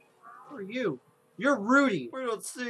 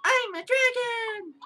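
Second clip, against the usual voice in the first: put-on very high, loud playful kid squeal; excited child; 8 dB louder, much slower than usual. Child playing dragon, high-pitched gleeful shrieking. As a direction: whoop and roar like a little kid playing.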